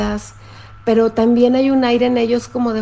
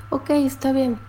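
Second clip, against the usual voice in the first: softer, minimal acknowledgement; curt dismissive reply; 2 semitones higher, 5 dB quieter than usual. Acted. Partner answering an angry complaint with few words, curt and dismissive. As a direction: brush it off quietly in few words. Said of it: "ellos nada más contestan".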